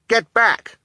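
high, loud warning cry. A narrator's reading. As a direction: cry out to drive attackers off. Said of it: "Klaus cried".

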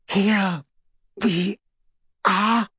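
strained through gritted teeth. A narrator's reading. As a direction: force it out with effort. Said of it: "between gritted teeth".

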